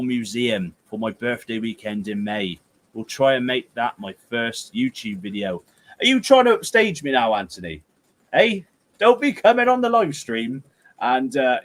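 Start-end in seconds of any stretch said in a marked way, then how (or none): 6.0-10.6 high, loud mock telling-off; mock indignation. Streamer jokingly accusing Anthony of upstaging him, mock indignant. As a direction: playfully challenge him, voice shooting up. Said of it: "I'm only joking".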